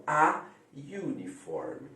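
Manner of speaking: "a uniform" said slowly, with you at the start of "uniform"; slow English model. Shown as a demonstration. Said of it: "aí entra a exceção". ("a uniform" pronunciado devagar, como iu)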